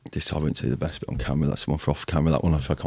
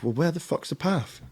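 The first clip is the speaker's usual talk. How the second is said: high, softer, baffled disbelief; incredulous question; 11 semitones higher, 4 dB quieter than usual. Retold or imitated. On the mountain to his mates, high baffled disbelief. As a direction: ask it high and lost. Lost on first real mountain.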